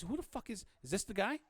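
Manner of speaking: high-pitched, incredulous demand; Abby. Acted. Game master as cop confronting a partner over an informant, incredulous. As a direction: demand to know, pitched well up. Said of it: "She says".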